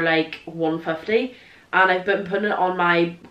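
ordinary talk

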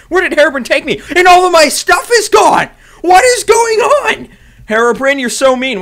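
high, loud disbelieving yell; shocked outcry. Real, not acted. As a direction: shout in alarm at what you see. Shocked at strange new world.

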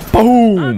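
loud, high, drawn-out explosion noise; shouted boom. Pretend. Player landing a hit, shouted boom. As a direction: punch out a triumphant boom. Calls out a kill.

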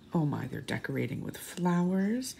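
ordinary delivery

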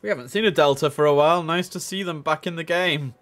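real delight, loud and fast; excited. Real, not acted. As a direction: burst out happily at a rare sight. Excited by a Delta arriving.

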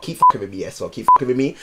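normal talking voice

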